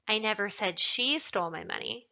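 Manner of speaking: "she" lifted and stressed; emphatic "she", as if someone else did it. Shown as a demonstration. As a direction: put the weight on "she". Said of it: "Perhaps somebody else stole it".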